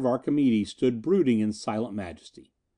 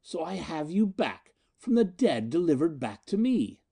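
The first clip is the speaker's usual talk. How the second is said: possessive tenderness; 5 semitones higher than usual. Acted. Miko reclaims lost Anita.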